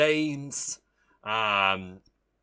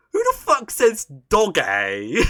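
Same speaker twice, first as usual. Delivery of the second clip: incredulous; 7 semitones higher, 8 dB louder, slower than usual. Real, not acted. Incredulous at the comment.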